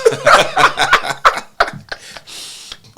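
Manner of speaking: loud, high burst of laughter; long, helpless high laugh; big laugh. Real, not acted. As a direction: crack up at your own story. Laughing at own accent story.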